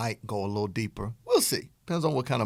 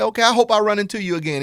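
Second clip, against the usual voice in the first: louder, higher, quick acted goodbye; eager suitor; 8 semitones higher, 8 dB louder, faster than usual. Suitor leaving after a first chat, lightly acted eagerness. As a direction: toss off a quick, high, loud parting line.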